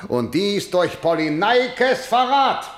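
high, slow, rising denunciation; angry accusation. Acted. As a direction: drive each word up towards the charge.

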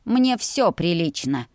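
usual speaking voice

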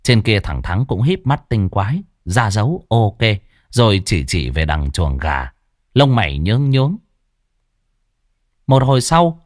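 usual speaking voice

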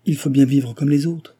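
a read-aloud flash of impatience; impatient. A narrator's reading. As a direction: snap it back, out of patience. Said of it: "dit madame Harel impatientée".

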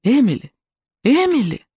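high, rising calls of a name; calling her name. A narrator's reading. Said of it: "И тут она услышала голос".

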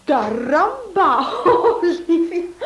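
slow mock "Caramba!" breaking into a laugh; laughing wife teasing her husband about his Spanish; laughing tease. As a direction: tease him, laughing through it.

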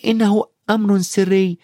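put-on high-pitched boy's reply; nervous high child voice; Harry, after gulping. A narrator's reading. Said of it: "ابتلع هاري ريقه وقال".

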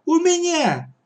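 very high indignant retort; high-pitched outrage. Acted. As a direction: snap back like an affronted girl. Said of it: "возмутилась Катя".

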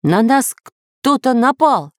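high, slow cry of alarm; serious cry. A narrator's reading. Samodelkin warns crew at the wheel, serious high cry. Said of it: "серьёзно крикнул Самоделкин".

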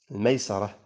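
الميسرة said with "a" after the sin, maysarah; fatha on the sin. Shown as a demonstration. Teacher giving a word's three vowelings, fatha in الميسرة. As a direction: sound الميسرة as may-SA-rah. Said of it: "فجاءت بالفتح".

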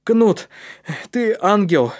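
loud, high burst of gratitude; delighted exclamation. A narrator's reading. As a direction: exclaim it with sudden joy. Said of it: "воскликнул Калиб".

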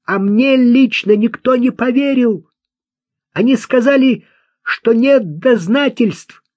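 high-pitched, aggrieved shouting; agitated outburst. A narrator's reading. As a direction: protest loudly, worked up and indignant. Климан вскочил, кричит от обиды. (возмущённый крик на высокой ноте)

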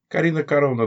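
usual speaking voice

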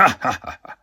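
triumphierendes Hahaha; Schurkenlachen. A narrator's reading. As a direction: nach der Prahlerei hämisch auflachen. Schurke lacht nach seiner Prahlerei. (kurzes, gespielt böses Lachen)

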